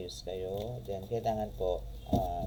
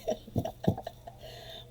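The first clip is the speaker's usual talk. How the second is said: soft voiceless chuckle; real wheezy giggle; amused laugh; 3 dB quieter than usual. Real, not acted. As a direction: let out an airy laugh. Amused at imagined viewer rumour.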